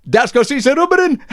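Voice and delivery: very high, loud Sheffield uncle; Uncle Jack voice. Retold or imitated. Uncle explaining the rubber tart gag, very high and loud. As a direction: squeal out the punchline. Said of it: "And Jack went".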